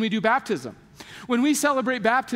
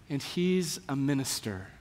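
a soft, much quieter, lower, proud whisper-like aside; proud grandma; 8 semitones lower, 7 dB quieter than usual. Retold or imitated. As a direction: lean in and murmur it like a proud grandmother. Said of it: "she leans in and she goes".

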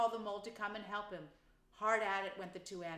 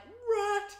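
much higher, louder delighted cry; cried; 12 semitones higher, 9 dB louder than usual. A narrator's reading. Mole finds Badger's door, delighted cry. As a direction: shout his name in surprise. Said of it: "he cried".

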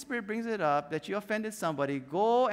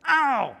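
sharp loud mock cry of pain; a sudden hammed-up ouch; 6 semitones higher, 10 dB louder than usual. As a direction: yelp like you've just been stung. Getting stung by offense.

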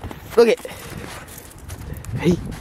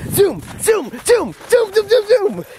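high, bouncy voice to rile up the dog; sing-song hype for a puppy; excited dog talk; 14 semitones higher than usual. Real, not acted. Owner cheering her dog on to run, high and excited.

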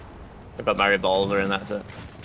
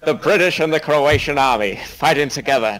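slow, louder epic narrator; mock trailer narration; 4 semitones higher, 4 dB louder than usual. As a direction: ham up the war intro.

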